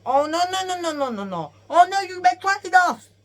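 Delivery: loud, very high frantic wailing; hammed-up cartoon dismay; mock panic. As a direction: cry out in pretend alarm. Acts panicked reaction in plot.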